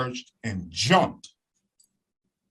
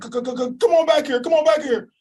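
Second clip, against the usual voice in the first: much higher and louder, stammering in fright; terrified stutter; 13 semitones higher, 4 dB louder, faster than usual. Retold or imitated. Neighbor lady calling her dogs back in fear, stuttering high and loud. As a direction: stutter out the words, scared stiff. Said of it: "and she stuttered".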